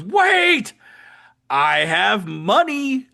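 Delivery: a high loud wait, then slow pleading; desperate plea. Retold or imitated. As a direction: cry out, then bargain slowly. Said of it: "the pumpkin goes".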